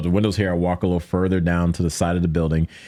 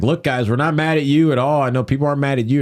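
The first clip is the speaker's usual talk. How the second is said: mild, higher-pitched re-enacted appeal; retold yelling; 7 semitones higher than usual. Protester yelling to police he isn't mad, retold yelling. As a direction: plead with them, pitch raised, only half yelling. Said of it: "And I'm yelling".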